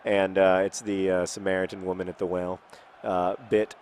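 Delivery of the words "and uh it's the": ordinary delivery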